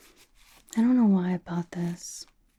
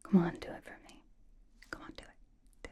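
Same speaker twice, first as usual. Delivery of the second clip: ordinary talk, nothing marked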